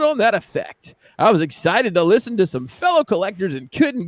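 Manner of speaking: high, bright radio host read; morning radio DJ voice. Shown as a demonstration. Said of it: "I'm gonna read this in the Morning Radio D J Voice".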